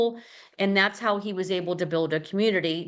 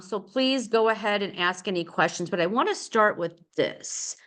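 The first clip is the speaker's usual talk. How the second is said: usual speaking voice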